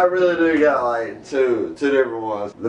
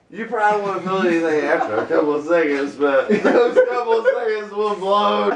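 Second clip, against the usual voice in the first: ordinary talk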